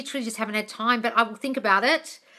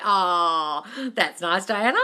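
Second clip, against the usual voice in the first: ordinary talk, nothing marked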